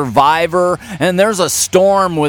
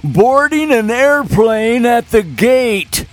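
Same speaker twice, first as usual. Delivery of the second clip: put-on old man voice; 5 semitones higher than usual. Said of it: "I will read these with my old man voice".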